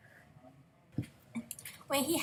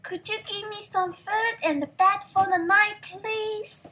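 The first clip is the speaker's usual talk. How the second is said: Jack asking; 8 semitones higher, 5 dB louder than usual. A narrator's reading. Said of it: "Jack asked".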